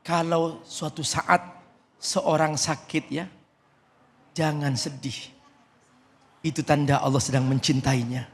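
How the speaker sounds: ordinary delivery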